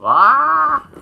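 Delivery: high, loud "aah" sliding upward; high held shout; loud rising yell. Pretend. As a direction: let out a sudden swooping cartoon cry. Mock cry as the camera shakes.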